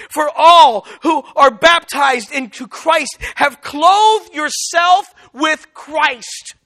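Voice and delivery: slow, weighty Bible reading; emphatic scripture reading. Acted. Preacher reads a verse to the congregation, slow and emphatic. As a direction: read the verse out slowly, stretching key words.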